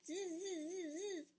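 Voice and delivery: high, soft buzzing monkey chatter. Acted. Monkeys mocking the peddler, high soft buzzing chatter. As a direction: buzz it back, mocking like a monkey. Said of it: "and said".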